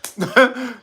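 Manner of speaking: short laugh after a slip; laughing at self. Real, not acted. Laughing after botching the word, amused. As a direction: burst into a brief chuckle at your own fumble.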